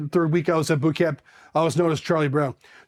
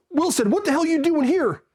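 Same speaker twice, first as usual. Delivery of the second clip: high-pitched drill instructor demand; mock military dressing-down without shouting; imitated drill instructor; 10 semitones higher than usual. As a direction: confront the recruit, voice pitched up. Senior drill instructor confronts recruit.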